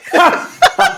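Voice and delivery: high, bright burst of laughter; amused laugh. Real, not acted. Laughing at being hung up on.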